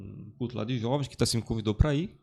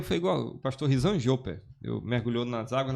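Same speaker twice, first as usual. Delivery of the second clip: ordinary talk, nothing marked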